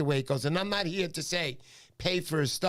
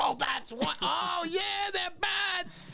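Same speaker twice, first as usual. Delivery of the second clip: hammed-up sudden understanding, pitched way up; mock realization; much slower than usual. Retold sudden realization, much higher.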